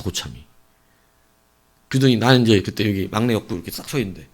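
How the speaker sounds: usual speaking voice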